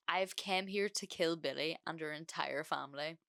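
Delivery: plain reading voice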